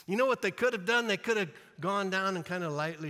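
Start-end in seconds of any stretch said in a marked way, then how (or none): none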